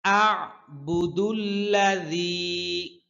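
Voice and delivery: slow melodic tajweed chant; measured Quranic cantillation; Quran recitation. Shown as a demonstration. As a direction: draw out each verse ending in song. Teacher chants the next verse.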